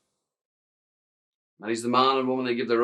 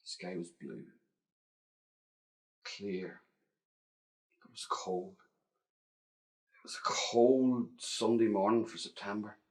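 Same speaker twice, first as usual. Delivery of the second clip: whispering; 3 dB quieter than usual. A narrator's reading. Quiet scene-setting whisper.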